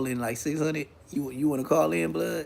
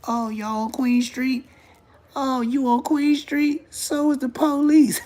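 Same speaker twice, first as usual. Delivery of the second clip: high, slow mock voice cracking into a laugh; mocking chatter; 9 semitones higher, slower than usual. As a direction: parrot the fool in a high drawn-out voice. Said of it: "They gonna say some brazy shit like that".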